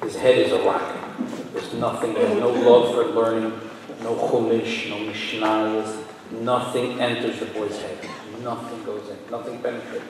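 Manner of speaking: a hint of a worried mother pleading; mother's lament. Mother pleads with the rabbi about her son, hushed and sorrowful. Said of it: "came one mother crying".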